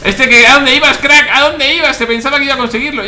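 high, loud mocking taunt. Real, not acted. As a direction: taunt the fleeing enemy loudly. Taunting fleeing enemy.

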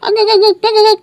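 very high, loud squealing cackle; shrill cackle. Real, not acted. Gamer cackles shrilly at a trap, loud and very high. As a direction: burst out in a shrieking cackle.

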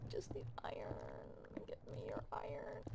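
breathy whisper, low and secretive. Acted. Gamer whispering his craving for iron, secretive. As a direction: whisper like a greedy goblin.

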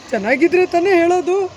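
loud, open-mouthed line reading; loud projection. Shown as a demonstration. Director demonstrates the line to child actor, loud. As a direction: throw the line out strongly. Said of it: "voice ಇನ್ನೂ ಜೋರು ಮಾಡು".

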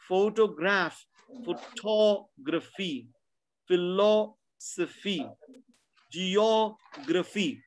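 photography, philosophy, geography said very slowly, stress on the syllable before the suffix; stressed before graphy. Shown as a demonstration. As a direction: draw out each word, leaning on TOG, LOS, OG. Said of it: "The sound before that is stressed".

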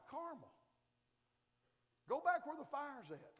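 ordinary talk, nothing marked

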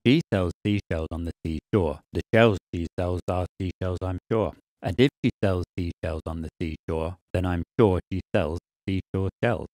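consonants cut out, only the vowel sounds left. Shown as a demonstration. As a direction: play just the vowels with every hiss removed. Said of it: "let's listen to the seashell's vowels".

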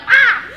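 sudden high, loud yelp; startled scream. Acted. Reacting to Jesus talking, a startled scream. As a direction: let out a mock-startled shriek.

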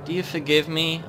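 mild, pleading question; gentle plea. Pretend. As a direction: ask meekly for forgiveness. Asks forgiveness gently.

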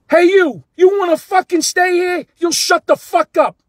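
very loud, high angry shout; screaming, hollering. Retold or imitated. Manager on the step hollering at a fan, imitated angry shout. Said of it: "in this tone".

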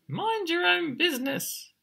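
very high chick retort; chirping chick. Acted. Emu chick answers back to the birds, high chirp. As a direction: chirp back cheekily in falsetto. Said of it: "But Emu Chick chirped".

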